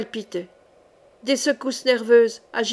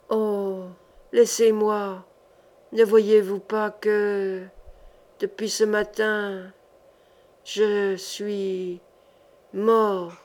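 dead man's voice; much slower than usual. Acted. The dead man begs the sorcerer, faint and slow. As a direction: drag it out like a weary corpse. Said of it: "C'étaient des sons morts".

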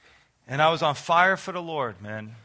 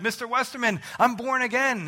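high-pitched happy announcement; re-enacted excitement; 6 semitones higher than usual. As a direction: act out breaking good news, voice lifted. Acts out announcing his conversion.

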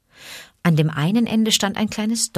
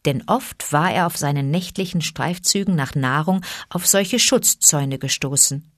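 plain reading voice

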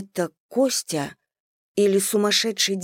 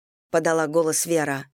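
plain reading voice

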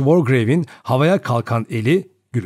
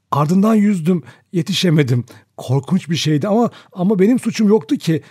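higher-pitched, halting, trembling account; 6 semitones higher than usual. A narrator's reading. Vera explaining the child's drowning, in a trembling voice. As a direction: confess haltingly, pitch raised and unsteady. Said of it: "titrek bir sesle anlattı".

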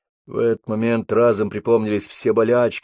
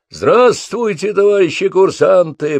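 loud, slow, high greeting; roaring; 10 semitones higher, 6 dB louder, slower than usual. A narrator's reading. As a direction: bellow the greeting to the troops. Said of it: "проревел майор".

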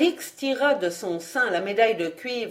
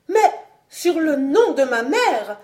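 high, impassioned oath; provoked young lady losing her self-control; exasperated cry; 7 semitones higher, 4 dB louder than usual. A narrator's reading. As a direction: swear it hotly, pitch well up. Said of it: "s'écria Alix".

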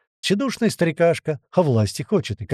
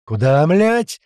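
loud, rising angry yell; bellowed; 3 semitones higher, 6 dB louder, much slower than usual. A narrator's reading. Big gunner yells at enemies in the corridor, bellowing. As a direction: roar it at the attackers. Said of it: "проорал Большой".

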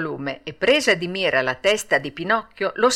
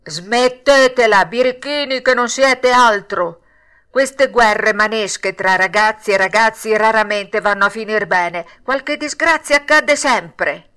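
crab with a cold; 7 dB louder than usual. A narrator's reading. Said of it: "gridò con una vociaccia di trombone infreddato". (granchio che sgrida a gran voce, come un trombone raffreddato)

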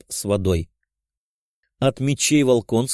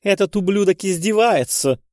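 indignant; 8 semitones higher than usual. A narrator's reading. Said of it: "с чувством произнесла Плещеева".

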